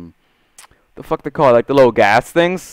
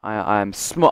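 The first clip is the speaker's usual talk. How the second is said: ordinary delivery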